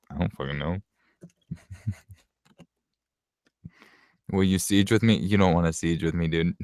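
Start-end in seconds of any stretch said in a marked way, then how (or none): 0.1-0.8 retold exasperated reply. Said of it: "And I'm like".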